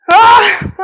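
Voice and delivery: loud, high yell of exertion; real strained workout yell; effort cry. Real, not acted. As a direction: cry out at the burn of the last rep. End of a burning set.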